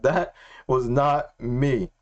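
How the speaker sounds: very slow quote, word by word; drawn-out, measured restatement; slow, deliberate. Retold or imitated. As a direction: spell it out with weighty pauses. Restating God's words slowly for emphasis.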